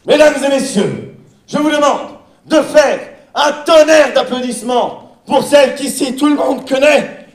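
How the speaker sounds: loud, high stage announcer voice. Acted. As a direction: introduce the star with showman flair.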